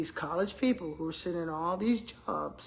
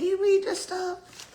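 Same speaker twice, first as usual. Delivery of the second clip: high, loud, drawn-out disbelief; incredulous; 12 semitones higher, 6 dB louder, slower than usual. Retold or imitated. Friend on the phone reacts to the paper, incredulous. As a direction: imitate a friend balking, pitched way up.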